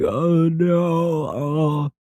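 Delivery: high, drawn-out wail; hammed-up whimpering kid; mock despair. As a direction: wail in exaggerated dismay. Retold lost kid despairing.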